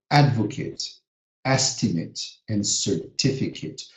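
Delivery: advocate, estimate, certificate ending in a short it; noun pronunciation. Shown as a demonstration. Teacher models the noun form, short it. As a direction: end "advocate" and "estimate" on a quick it sound. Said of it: "It's going to sound it".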